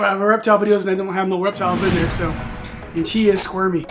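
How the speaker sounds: usual speaking voice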